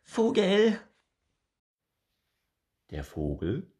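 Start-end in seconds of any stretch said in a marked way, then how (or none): 0.0-0.8 very high, loud squirrel call. Acted. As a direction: shout up to the bird.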